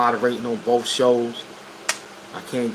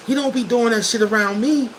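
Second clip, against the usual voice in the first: far higher, incredulous protest; mock-innocent defense; 12 semitones higher than usual. Friend defending an accused snake, mock-innocent. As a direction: plead ignorance way up high.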